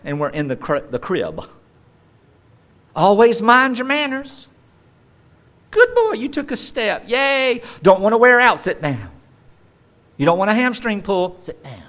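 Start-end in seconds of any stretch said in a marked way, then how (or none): 3.0-11.8 put-on high, loud fussing over a toddler; hammed-up parental worry and cheering; overbearing parent. Parent coaching a toddler's first steps, high overbearing fussing. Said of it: "constant overbearing worry".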